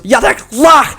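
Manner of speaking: high mock foreign command; imitated execution order. Boss orders the hanging, imitated high shouted command. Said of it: "And he goes".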